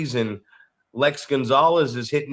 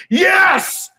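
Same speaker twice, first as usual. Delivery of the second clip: imitating a thrilled shout of yes, much higher and louder; excited yell; 6 dB louder than usual. Young wrestler celebrating alone after the call, excited shout. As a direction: burst out with a private victory cry. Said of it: "with such enthusiasm".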